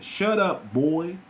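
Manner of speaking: put-on dismissive, with boy tacked on the end; a mild mock rich man belittling the listener; condescending shut up boy. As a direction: talk down to him, lean on the boy. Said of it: "and then add boy at the end".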